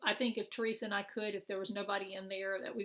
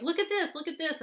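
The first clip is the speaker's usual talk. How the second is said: excited squeal; 7 semitones higher, 6 dB louder than usual. Retold or imitated. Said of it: "Teresa's like".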